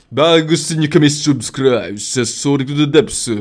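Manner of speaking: Tagalog in a mock Japanese accent. Shown as a demonstration. Asking viewers to subscribe, Japanese accent. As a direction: make the plug sound Japanese. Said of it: "Japanese, uh".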